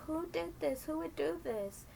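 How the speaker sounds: high, upset questioning; high mock distress; distressed old lady. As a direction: plead to nobody in particular.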